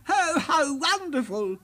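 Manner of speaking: high, loud delighted king; delighted king cry. A narrator's reading. King cries out at the juggling lion, delighted. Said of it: "he cried".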